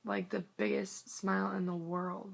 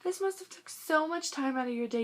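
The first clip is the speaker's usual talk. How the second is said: imitating gushing girl; 9 semitones higher than usual. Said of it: "and she was like".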